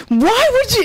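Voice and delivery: high-pitched mock yell; imitating yelling. Daughter retells dad's sudden outburst at Apple Store, mock yelling. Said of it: "he just starts yelling".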